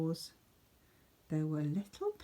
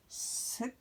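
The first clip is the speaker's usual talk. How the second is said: whispered delight, a little higher; whispered scrumptious; relishing whisper; 6 semitones higher, 7 dB quieter than usual. Acted. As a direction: savour the word. Rabbits relish the metal.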